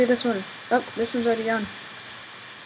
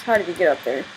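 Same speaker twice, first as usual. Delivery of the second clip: ordinary talk, nothing marked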